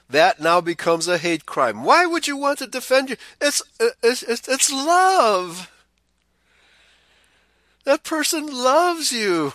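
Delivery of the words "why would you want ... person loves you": high, cloying mocking voice; mock liberal. To listeners, mocking a liberal's excuse in a high sugary voice. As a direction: mimic a syrupy do-gooder, pitched well up.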